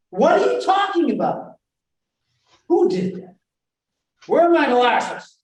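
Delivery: loud high yell, hitting the question word; hammed-up shouted questions, first word punched; outraged questioning. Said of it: "that what word usually gets".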